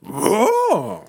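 high, uncertain dragon roar; a roar that doesn't know itself; confused roar. Shown as a demonstration. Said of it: "That sounds like a confused roar".

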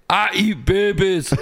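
hammed-up Scottish accent, drawn out. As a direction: do a comic Scottish character, slowly. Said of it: "do your best Scottish accent".

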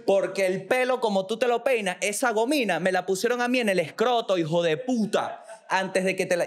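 high, furious elephant telling off the delegate; elephant cursing. Acted. Elephant answering the Venezuelan delegate, high and furious. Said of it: "Y el elefante le dice".

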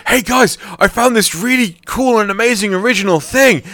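high, loud eager newbie voice. Retold or imitated. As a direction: gush like a keen beginner. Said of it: "It's like".

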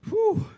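a loud high breathless whew; loud winded whew. Real, not acted. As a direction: let out a big exhale after the joke. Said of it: "after the prank story".

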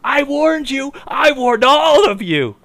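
very high, stretched-out hammy wail; mock doomsayer's cry. Molasses guy atop a building to townsfolk, mock vindicated cry. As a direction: cry it out over the rooftops, drawing out the end. Acted prophet shouting from a building.

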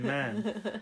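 high-pitched Geordie "man"; Geordie accent. Retold or imitated. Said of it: "it just sounds like he's, he's a Geordie".

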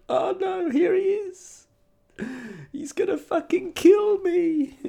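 high, soft frightened voice; nervous shop lady. Retold or imitated. Shop lady dreading the angry customer, high soft frightened. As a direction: voice the scared shopkeeper's thoughts. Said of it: "a little gulp in her throat".

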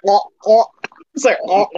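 high squeaky pufferfish moan; puffed-up pufferfish. Retold or imitated.

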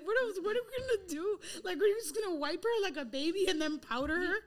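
ordinary delivery

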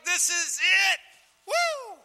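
high, loud whoop of joy; excited cheer. Retold or imitated. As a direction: shout it like you've just struck gold. Excited recollection of expected revival.

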